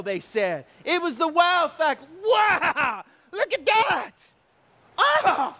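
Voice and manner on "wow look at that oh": high, exclaimed astonishment; acted wide-open, high-pitched awe; amazement. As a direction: cry out like an amazed crowd. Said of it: "with amazement".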